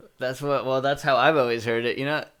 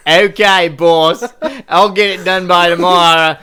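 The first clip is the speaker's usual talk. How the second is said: loud, higher-pitched hammed-up Australian; 5 semitones higher, 11 dB louder than usual. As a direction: belt it out like a cartoon Aussie. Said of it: "just act like an offensive stereotype".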